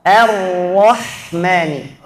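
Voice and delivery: Ar-Rahmani slow, thin a, thick ra, thin ha; teacher models the word; correct Ar-Rahmani. Shown as a demonstration. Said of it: "Setelah ra tebal".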